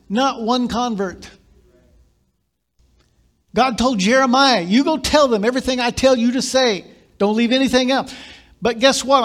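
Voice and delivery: loud, high, driving sermon delivery; fervent pulpit preaching building to a peak; full-force preaching. Acted. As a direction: preach it hard to the congregation.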